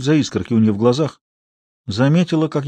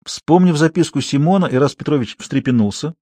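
plain reading voice

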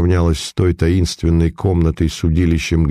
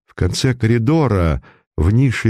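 usual speaking voice

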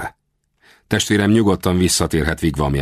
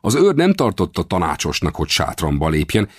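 usual speaking voice

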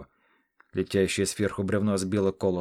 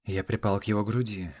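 plain reading voice

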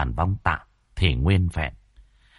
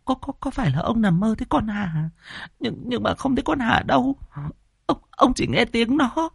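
put-on high-pitched frightened old wife; scared, quavering questions pitched way up; trembling. A narrator's reading. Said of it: "thì run run".

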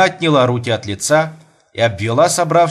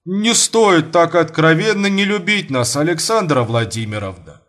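heavily; 5 semitones higher than usual. A narrator's reading. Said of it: "тяжело проговорил Мамут".

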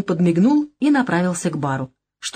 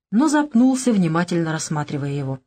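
ordinary delivery

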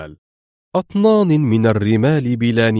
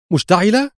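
much higher, rising incredulous cry; agitated exclamation; 9 semitones higher than usual. Acted. Laura hearing the plane burned, high excited question. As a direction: seize on the word in excitement. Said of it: "هتفت بكل انفعالها هذه المرة".

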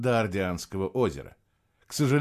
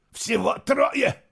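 much higher, slow incredulous question; outraged disbelief; 13 semitones higher, much slower than usual. Acted. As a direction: spit out the disbelief. Emperor outraged at number.

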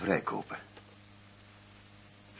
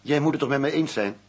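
louder and higher, pressing the boss; indignant raised voice at his superior; heated protest; 7 semitones higher, 8 dB louder than usual. Acted. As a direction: demand an answer, raised and indignant. Agent argues with his boss.

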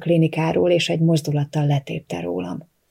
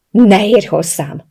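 loud, drawn-out cry of refusal; full-throated shout; 3 semitones higher, 7 dB louder, slower than usual. A narrator's reading. Woman fighting off the man pinning her down, shouting. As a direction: shout it as a firm warding-off. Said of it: "ordítottam torkom szakadtából".

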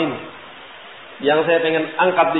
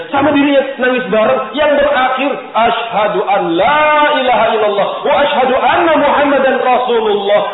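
loud, high, swelling climax; emotional climax; 8 semitones higher, 5 dB louder than usual. Real, not acted. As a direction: lift the room at the shahada. Climax of conversion story.

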